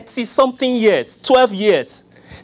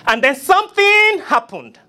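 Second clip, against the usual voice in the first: preacher refrain; 10 semitones higher than usual. Acted. Preacher's refrain, raised pitch.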